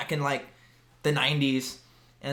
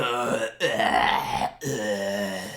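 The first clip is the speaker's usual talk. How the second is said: low, sluggish groans, sinking lower each time; a hint of a drunk man groaning; mock drunk groan; 6 semitones lower, much slower than usual. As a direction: lightly imitate a sloppy drunk grunting. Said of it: "he's just like".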